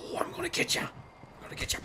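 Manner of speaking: unvoiced teasing whisper; playful stalking whisper. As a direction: whisper it as if creeping up on prey. Stalking an enemy in game.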